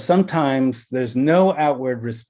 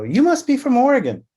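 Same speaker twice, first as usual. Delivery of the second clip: high, quick, louder retold guess; teasing guess; 12 semitones higher, 5 dB louder, faster than usual. Guessing where the blushing client is from, high teasing voice. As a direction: leap up high and rush the guess out.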